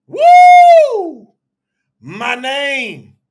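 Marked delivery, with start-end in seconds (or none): 0.1-1.3 very high, very loud "woo"; long, full-voiced celebratory whoop; exultant whoop. Real, not acted. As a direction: holler a piercing whoop of praise. Exultant whoop during sermon.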